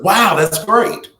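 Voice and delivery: mock delighted parent; matching excitement. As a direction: meet the kid's joy with your own. Parent responding to good news.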